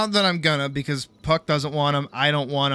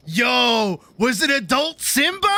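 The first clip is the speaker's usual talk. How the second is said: excited yo; 6 semitones higher, slower than usual. Pretend. Teasing son excitedly.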